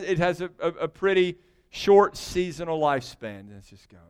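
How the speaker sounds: ordinary talk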